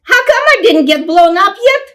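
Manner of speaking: put-on a defiant small child; little girl's voice. Little sister protesting she won't be a person, high child voice. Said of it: "children's voice".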